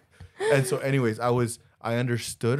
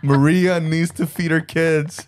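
slow, high, hammed-up begging; mock plea for sales; 7 semitones higher, 10 dB louder, slower than usual. Joking to the listeners about shirt sales, in a hammed-up pleading tone. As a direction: lay on the guilt trip, slowly and loudly.

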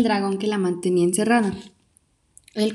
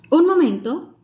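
sudden interruption; 8 semitones higher, 5 dB louder than usual. Acted. Princess interrupts the tale.

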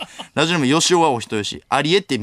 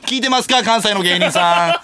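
higher, drawn-out call to distant colleagues; raised voice stretching the final word; 6 semitones higher, 4 dB louder than usual. Acted. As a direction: holler it out in mock appeal. Calls out to Kansai comedians.

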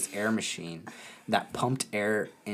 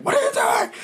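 squeaky disbelief; 6 dB louder than usual. Retold or imitated. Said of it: "I was like".

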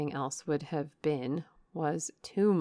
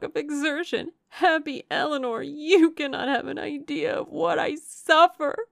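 high-pitched, impassioned reproach, swelling louder; anguished outburst; 9 semitones higher, 6 dB louder than usual. A narrator's reading. Suffering younger sister reproaching happy Eleanor, anguished outburst. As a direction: lash out from misery, voice high throughout. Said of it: "cried Marianne".

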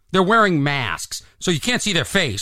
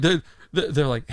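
ordinary talk, nothing marked

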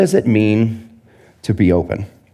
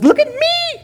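much higher squealed plea for attention; mocking Instagram poser; slower than usual. Mocking Instagram posers, high squealed show-off. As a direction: squeal it like a needy influencer. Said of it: "Instagram is the most famous example".

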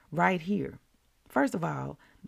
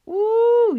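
very high, loud ecstatic ooh; excited ooh; 11 dB louder than usual. Real, not acted. Preacher thrilled by the quote, loud high ooh. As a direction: cry out with delight.

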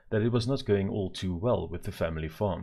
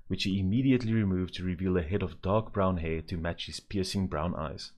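usual speaking voice

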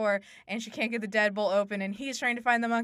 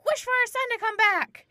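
high, loud pleading wife; acted desperate demand; 7 dB louder than usual. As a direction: play a wife demanding the impossible. Wife's demand in retold story.

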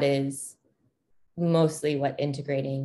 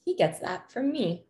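higher-pitched "oh" of recognition; imitated inner realization; 8 semitones higher than usual. Parent seeing traits mirrored in the child, higher-pitched imitated realization. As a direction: voice your own thought, pitched well up. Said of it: "mirroring back, like".

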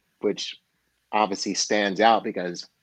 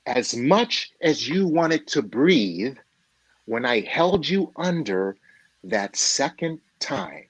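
slow, weighty moral; slower than usual. Acted. Older mentor to young man seeking success, slow weighty moral. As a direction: let each phrase land like a punchline lesson.

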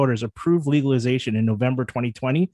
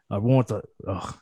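ordinary talk

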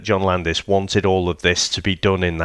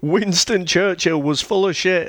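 high mock rant, pitched way up; mocking a ranting drunk, much higher; mocking rant quote; 9 semitones higher than usual. Mocking the ranting taxi driver.

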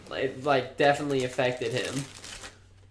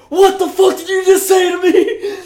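furious drill sergeant bellow, much higher and louder; drill sergeant shout; 14 dB louder than usual. Retold or imitated. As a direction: scream it like an outraged drill sergeant. Drill sergeant yelling at recruit.

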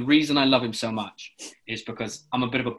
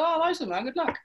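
cheery well-wishing; 5 dB quieter than usual. Retold or imitated. Speaker recalls wishing him luck.

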